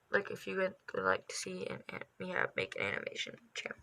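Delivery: dropping to a hushed whisper. Real, not acted. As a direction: whisper so no one hears. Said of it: "Sorry, can't talk".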